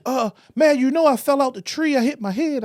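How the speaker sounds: imitating the sheepish guy. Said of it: "he said".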